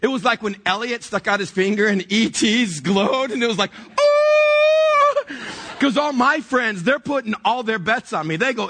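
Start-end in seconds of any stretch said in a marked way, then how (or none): 4.0-5.2 long, loud, very high "ohhh"; mock cosmic awe, held out at full voice; imitated high movie-moment cry; awed long wail. Imitates E.T.'s glowing-finger moment.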